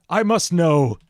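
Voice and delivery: demanding. Acted. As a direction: read it like a frantic scientist demanding an answer. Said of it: "he demanded".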